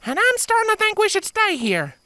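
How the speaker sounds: put-on high timid little girl; scared filly. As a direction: say it timidly, wanting to hide. Scared filly wants to stay.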